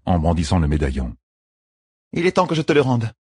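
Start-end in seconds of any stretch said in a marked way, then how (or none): 2.1-3.1 higher-pitched, lightly voiced queen; queenly voice, pitched up; earnest young queen. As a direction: hand it back with sincere acknowledgement. Voices Elaine returning medallion.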